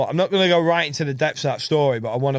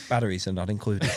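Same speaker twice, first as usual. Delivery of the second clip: lower, laughing advert disclaimer; mock radio voice; 4 semitones lower, 5 dB quieter than usual. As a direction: drop into a pretend radio announcer's small print. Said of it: "trying to do that radio voice".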